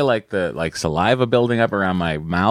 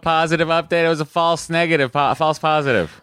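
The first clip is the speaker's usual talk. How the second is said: excited; 8 semitones higher than usual. Real, not acted. Cohost reacts to listener's update.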